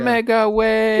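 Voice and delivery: high, long-held playful call; mock battle cry. Co-host repeats the joke name, mock battle cry. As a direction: call it out high and stretch the last word.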